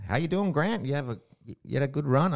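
high-pitched imitation of an American woman friend; American friend from Florida. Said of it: "she said".

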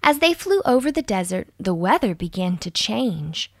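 usual speaking voice

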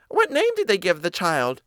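high little mouse voice; inquiring mouse. Acted. Mouse asking after the godchild's name, high and curious.

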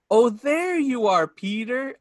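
high, loud, slowly drawn-out delight; quoted movie discovery. Retold or imitated. Fan quoting the film on finding a lost item, drawn-out delight. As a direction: stretch it out like finding someone long lost. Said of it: "I always go".

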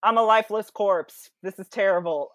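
loud, hammed-up wailing; mock lament. Creon carrying his dead son, in a loud mock lament. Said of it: "He's just like".

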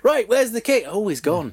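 a baffled squaddie finding the king gone; soldiers waking. Acted. Soldiers waking to find their king has fled, puzzled.

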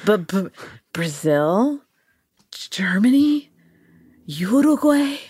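haltingly, rising into a question on each word; sounding out words. Acted. Man sounds out words etched on a disc, haltingly. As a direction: read it out bit by bit, unsure. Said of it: "he sounds out the word".

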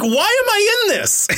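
very high, incredulous squeal of a question; bewildered retold protest. Recalling being cast in the school show, high bewildered protest. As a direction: retell it as a high-pitched comic wail.

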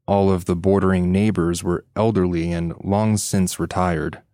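ordinary delivery

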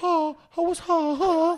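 high squawking seagull imitation; seagull call. Shown as a demonstration. Doing a seagull call on request, high squawks. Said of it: "go on, you do it".

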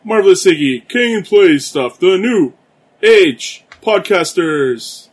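booming ringmaster announcement. Pretend. Podcast intro announcement.

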